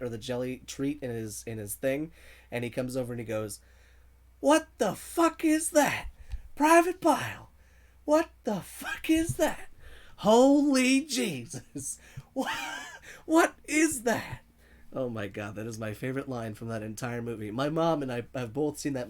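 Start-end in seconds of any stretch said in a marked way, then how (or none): 4.4-14.4 screaming drill sergeant, high and much louder, slowed; drill sergeant impression. Screaming at a recruit over a jelly donut, furious drill sergeant. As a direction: bellow it like a furious drill instructor. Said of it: "I would do that voice".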